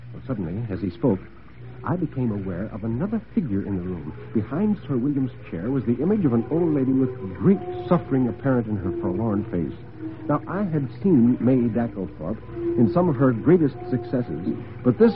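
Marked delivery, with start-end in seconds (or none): none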